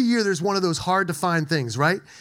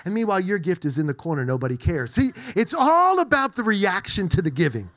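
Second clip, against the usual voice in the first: ordinary talk, nothing marked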